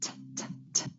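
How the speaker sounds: crisp unvoiced T, tongue tip tapped behind the teeth; true T sound. Shown as a demonstration. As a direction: say just the T burst, separately each time. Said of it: "start first with the true T".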